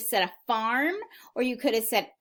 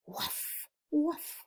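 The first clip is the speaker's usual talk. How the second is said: small, hushed woofs; quiet dog bark; 6 semitones higher, 8 dB quieter than usual. Acted. Dog after the farmer's scolding, quiet little woofs. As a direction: bark softly under your breath. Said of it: "they quietly made their noises".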